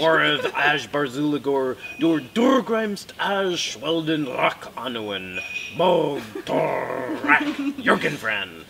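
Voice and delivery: loud, drawn-out Dwarvish rant with rolled uvular R's; very angry dwarf. Acted. Angry dwarf complaining about visitors in the mountains, hammed-up furious roar. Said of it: "it's this very angry dwarf".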